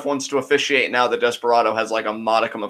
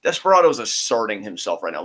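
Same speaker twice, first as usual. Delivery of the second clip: ordinary delivery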